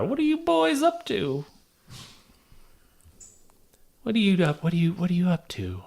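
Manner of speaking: put-on high creaky old woman; old lady voice. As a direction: play a nosy old lady. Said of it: "doing an old lady voice".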